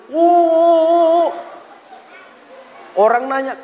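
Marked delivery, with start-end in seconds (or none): 0.1-1.3 a sudden high, loud mock wail; high, loud mock howl of grief. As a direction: burst out like a man rolling on the ground, crying. Mimicking the widower's loud wailing.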